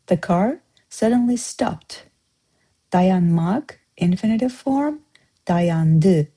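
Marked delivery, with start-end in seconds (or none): none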